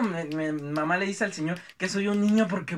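ordinary talk, nothing marked

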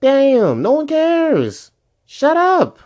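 high, loud exasperated yelling; shouting in high-pitched anger; angry outburst. Real, not acted. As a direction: snap at the haters in fury. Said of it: "this is the first or second time we hear you this angry".

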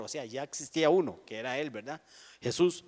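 ordinary talk, nothing marked

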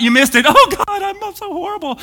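hammed-up self-pity, rising high; mock despair. Shown as a demonstration. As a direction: wail over the mistake, overdone. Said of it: "That's not".

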